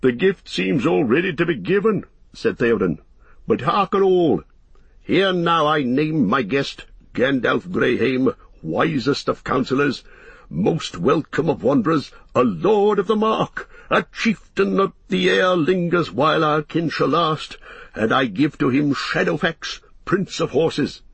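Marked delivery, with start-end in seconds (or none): 0.0-2.0 higher-pitched kingly character voice; stately, lifted delivery; Theoden, gracious. A narrator's reading. Narrator voices King Theoden. 3.5-21.0 high, ringing royal proclamation; formal herald-like declaration, swelling on the titles; Theoden, proclaiming. A narrator's reading. Narrator voices Theoden proclaiming.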